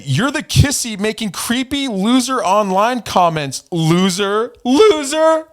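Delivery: high mocking voice, climbing to a squeal; mock rival insult. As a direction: imitate his name-calling, drawn out and high.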